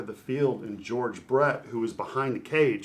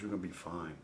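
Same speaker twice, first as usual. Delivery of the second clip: soft, lower, retold comfort; quiet reassurance; 4 semitones lower, 8 dB quieter, faster than usual. Pitcher retelling a legend's comfort to him, soft low reassurance.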